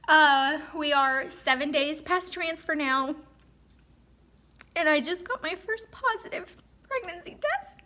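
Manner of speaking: mother crying with joy, sharing her first positive test; happy crying. Real, not acted. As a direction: speak through joyful tears. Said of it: "I don't know why I'm crying".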